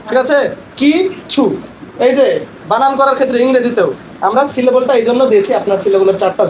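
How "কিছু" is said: কিছু split into কি and ছু; syllable by syllable. Shown as a demonstration. Trainer gives a syllable example, কিছু cleanly split. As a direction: say কিছু as two separate, higher-pitched beats. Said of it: "এক প্রয়াসে যতটুকু উচ্চারণ করা যায়".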